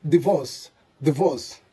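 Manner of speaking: divorce as di-VOS with short "ee/i"; correct divorce. Shown as a demonstration. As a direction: repeat divorce with a short i in the first syllable. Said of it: "should be pronounced as".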